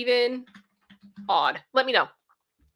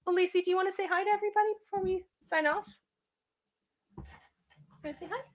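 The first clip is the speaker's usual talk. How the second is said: talking to dog; 8 semitones higher, 6 dB quieter, much faster than usual. Acted.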